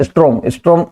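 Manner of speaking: "storm" garbled into "strom"; common mispronunciation. Shown as a demonstration. Teacher quoting what everybody gets wrong, "storm" said as "strom". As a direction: say "storm, storm" as is-TROM, r before the o. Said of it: "इसको सब लोग गलत बोल जाते हैं".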